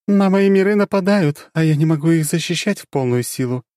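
high and loud, distressed; dark goddess worried; troubled goddess. Acted.

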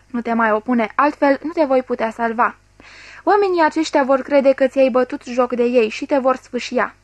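ordinary delivery, nothing marked

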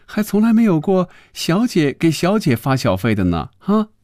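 high-pitched giggly woman's voice; tickled, sing-out high delivery; laughing, amused. A narrator's reading. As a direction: open with a titter, then tease in a high voice. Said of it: "笑着说".

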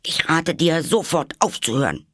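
high, firm self-scolding; stern advice. A narrator's reading. Alice ordering herself to stop crying, stern. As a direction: order yourself to stop at once. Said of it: "sagte Alice ganz böse zu sich selbst".

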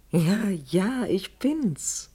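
ordinary talk

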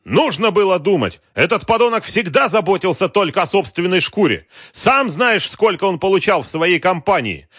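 high, loud raging tirade; furious yelling. A narrator's reading. Wolf stamping his feet at Galkin, yelling. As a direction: bellow insults in a fury, voice pushed high. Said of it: "орал Вольф".

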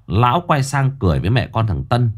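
usual speaking voice

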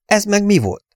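high-pitched alarmed question; shocked, high exclamation; startled cry. A narrator's reading. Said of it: "kiáltotta Luke".

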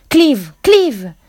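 building to high, loud calls of the name; imploring shout. A narrator's reading. Jack cries out to Clive, now the beast, imploring shout. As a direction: call out to the lost friend, begging. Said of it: "urlò Jack implorante".

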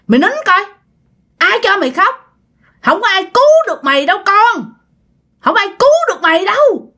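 high, harsh spirit voice; spirit scolding the girl. Retold or imitated. Spirit scolding possessed girl to stop crying, high harsh voice. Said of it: "Nói vậy các bạn".

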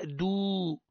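الدو dragged into a long "duuu"; over-lengthened vowel. Shown as a demonstration. Teacher showing the wrongly added waw, over-lengthened vowel. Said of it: "عملت واو صلة".

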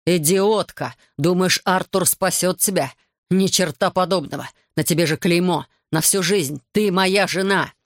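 plain reading voice

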